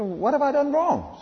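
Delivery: high pleading protest of an innocent king. Retold or imitated. The king protesting to God in a high plea. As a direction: cry out your innocence, voice lifted high. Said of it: "He says".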